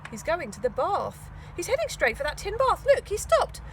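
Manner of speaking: building excitement as she watches; excited edge. Acted. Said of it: "Her voice took on an excited edge".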